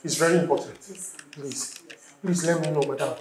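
very soft, low, hesitant; meek pleading. Acted. Husband asking nurse about the accident, hushed meek pleading. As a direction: beg quietly, stopping and starting.

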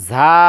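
ঝা as a thin, drawn-out z; thin articulation. Shown as a demonstration. Teacher models the letter, thin sibilant ঝা. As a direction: whistle the z of ঝা lightly at the teeth. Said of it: "পাতলাভাবে আদায় হবে".

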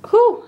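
overwhelmed squeaky whew; high whew. Real, not acted. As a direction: exhale in excited relief. Overwhelmed by the cards.

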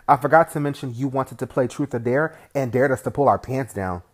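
normal talking voice

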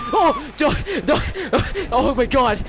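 high, startled panicked yelps; scared horror game streamer. Real, not acted. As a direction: panic as the killer appears.

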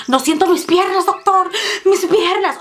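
hammed-up patient panicking about her legs; intense panic. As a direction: overdo the worried patient, laughing at yourself. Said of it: "me puse intensa".

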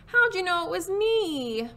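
high, drawn-out turkey moan. Acted. Disguised Turkey found out by Cow, plaintive moan. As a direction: moan it slowly, stretching the words up high. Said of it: "moaned Turkey".

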